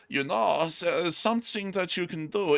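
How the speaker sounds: high-pitched imitated German accent. Host quotes the Strangelove adviser, put-on German accent. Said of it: "talking this German accent".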